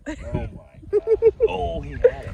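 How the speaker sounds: very high, loud, slow cry; excited. Real, not acted. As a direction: shriek in disbelief. Horse nearly gets apple.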